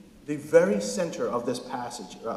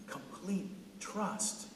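whispered; 3 semitones higher, 12 dB quieter, much slower than usual. Shown as a demonstration.

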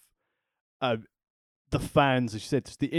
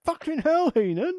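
exasperated outburst. Real, not acted.

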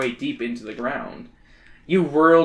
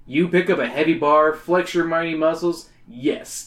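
usual speaking voice

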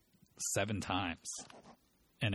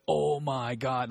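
slow, loud, higher awe; amazed kid; 5 semitones higher, 7 dB louder, much slower than usual. Retold or imitated. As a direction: imitate a sulky teen suddenly amazed. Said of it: "bummed as fuck to".

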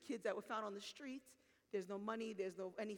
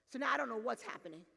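ordinary talk

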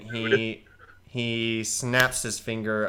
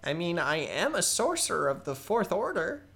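higher, eager nervous goblin rambling; young goblin sorcerer voice; 12 semitones higher, 3 dB louder than usual. Acted. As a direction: play a keen but unsure goblin kid. GM holds Balthazar voice.

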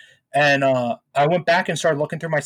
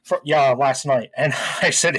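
ordinary talk, nothing marked

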